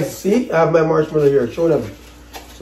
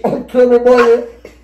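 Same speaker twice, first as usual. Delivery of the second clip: words mushed by marshmallows; mouth full; 4 semitones higher, 4 dB louder, slower than usual. Real, not acted. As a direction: talk through a crammed mouth.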